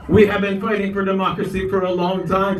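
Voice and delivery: usual speaking voice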